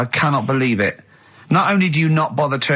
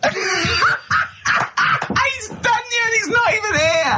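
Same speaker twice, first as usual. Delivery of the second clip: laughing fit. Real, not acted. Cracking up at Carl.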